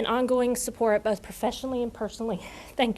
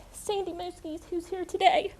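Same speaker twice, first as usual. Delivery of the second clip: ordinary delivery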